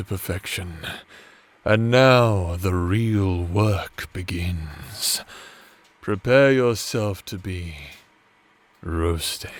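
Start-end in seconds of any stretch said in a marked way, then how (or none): 1.6-9.5 low, slow menacing purr, roasted whispered; villainous witch voice. Acted. Teasing partner while basting turkey, low slow menacing witch voice. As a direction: drop into a playful evil witch. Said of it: "you like when I talk like this".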